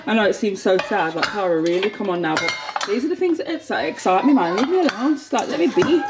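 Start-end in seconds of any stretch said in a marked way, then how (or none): none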